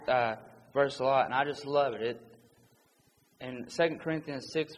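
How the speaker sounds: ordinary talk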